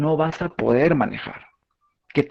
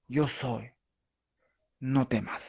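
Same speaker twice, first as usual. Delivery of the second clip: softer, tender comfort; gentle reassurance; 9 dB quieter, slower than usual. Acted. God encouraging the listener, soft and tender. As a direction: hush it like a soothing promise. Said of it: "Dios nos alienta con esas palabras".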